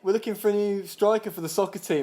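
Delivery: high, loud, chirpy patter; doorstep sales pitch. Pretend. Acting out the striker pitch on a doorstep, sales patter. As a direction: launch into the pitch brightly.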